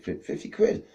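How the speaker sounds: high breathless stammer of disbelief; stunned stammer. Retold or imitated. Man scratching a winning card, stunned and stammering. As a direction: gasp out the amount, barely believing it.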